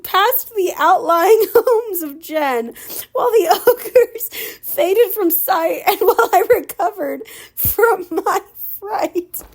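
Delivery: high, giggling through the words; can't stop laughing while reading; laughing fit. Real, not acted. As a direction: reader cracking up mid-sentence.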